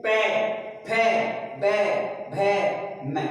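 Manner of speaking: each letter said alone, the two lips pressed together; lip sounds demo. Shown as a demonstration. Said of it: "अब है उ". (ओष्ठ्य वर्णों का अलग-अलग स्पष्ट उच्चारण)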